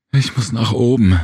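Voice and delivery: panicked. A narrator's reading. Serena begging to get out of the shaft, panicked. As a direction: blurt it out in panic. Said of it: "Serenas Stimme klang panisch".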